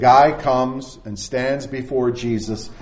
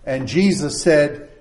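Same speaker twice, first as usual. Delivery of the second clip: ordinary delivery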